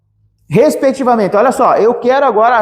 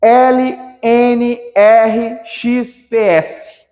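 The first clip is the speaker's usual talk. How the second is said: very slow letter by letter; 3 semitones higher, much slower than usual. Shown as a demonstration. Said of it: "teacher spells letters".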